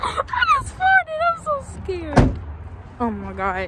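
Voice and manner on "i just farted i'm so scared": much higher, slow, squeaky and scared; high slow squeal of nerves; high nervous squeak. Real, not acted. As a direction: squeak it out in nervous giggly fright.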